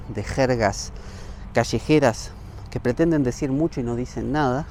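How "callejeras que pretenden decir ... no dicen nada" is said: ordinary talk, nothing marked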